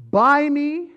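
high, loud whiny plea; mocking high wheedling voice; pleading buy me. Shown as a demonstration. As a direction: beg like a spoiled child. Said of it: "it's not a really long distance between".

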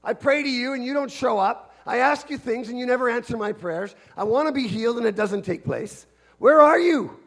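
loud, high, pleading cry to God; angry prayer. Acted. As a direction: hurl the question upward like an abandoned child. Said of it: "we even cry that same cry".

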